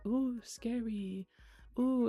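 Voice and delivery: slow, hammed-up "Ooh, scary"; lightly imitating a cheesy ghost guide; mock spooky guide. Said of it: "they're like".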